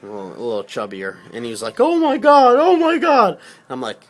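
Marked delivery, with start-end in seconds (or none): 1.8-3.4 high, loud freaked-out yelp; panicked shout. Retold or imitated. Baby cop freaking out at the scene, high panicked shout. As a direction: shriek it like a scared young cop.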